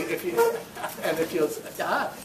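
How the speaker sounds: ordinary delivery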